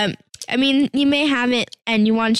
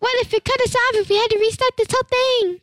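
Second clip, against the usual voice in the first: excited panic; 9 semitones higher than usual. Real, not acted. Kid panicking to viewers about losing the recording, rushed. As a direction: blurt it out all at once.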